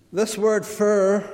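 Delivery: ordinary delivery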